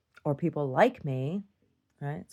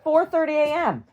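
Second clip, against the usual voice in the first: much higher, louder, indignant repeat; outraged disbelief; 13 semitones higher, 7 dB louder than usual. Real, not acted. Repeating the early hour back to her husband, outraged. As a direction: hit the hour in loud disbelief.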